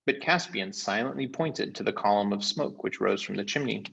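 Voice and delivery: usual speaking voice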